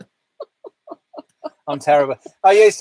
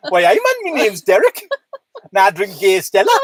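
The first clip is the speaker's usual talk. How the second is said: Newcastle Welshman. Pretend. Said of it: "a Newcastle Welshman".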